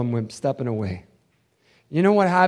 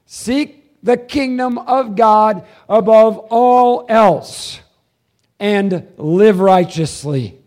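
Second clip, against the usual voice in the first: loud, slow, lifted proclamation; solemn scripture proclamation; 7 semitones higher, 10 dB louder, much slower than usual. Acted. Pastor proclaiming Jesus's bottom line to the church, loud and slow. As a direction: declare each word slowly. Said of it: "Hear it".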